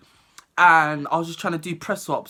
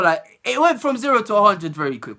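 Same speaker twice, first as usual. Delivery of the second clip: ordinary delivery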